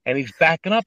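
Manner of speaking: usual speaking voice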